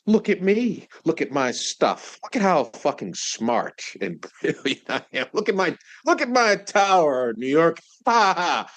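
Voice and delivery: high, loud, crowing show-off; hammed-up braggart, laughing at his own brilliance; male ego boasting. Iron Man showing off his tower, hammed-up boasting. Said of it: "back and forth with the male ego".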